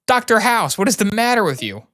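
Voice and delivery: high, sharp outraged mother; indignant mom. Acted. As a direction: scold the doctor in shock. Mother scolding doctor.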